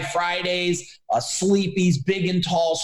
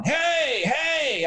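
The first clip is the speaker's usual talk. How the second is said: imitated yell; 9 semitones higher than usual. Someone sleeping in the dumpster calling out, mock yell. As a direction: call out the hey up high, half-acted. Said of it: "somebody would be yelling".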